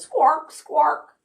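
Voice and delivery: a touch of real duck squawk. Acted. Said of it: "all he could say was".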